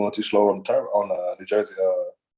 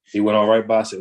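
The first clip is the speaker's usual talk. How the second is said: normal talking voice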